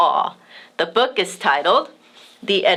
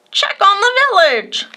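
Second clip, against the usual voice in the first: parrot voice; 11 semitones higher, 3 dB louder than usual. A narrator's reading. Parrot kite instructs.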